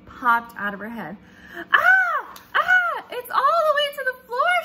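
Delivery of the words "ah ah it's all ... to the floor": high, loud panicked shriek; loud upset yell. Acted. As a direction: shriek it in horror at the sweater. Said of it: "she yelled".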